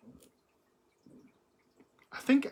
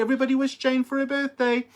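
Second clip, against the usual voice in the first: rallying cheer; 7 semitones higher, 5 dB louder than usual. Real, not acted. Rallying viewers for birthday.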